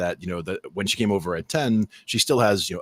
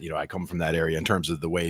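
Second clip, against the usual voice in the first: ordinary talk, nothing marked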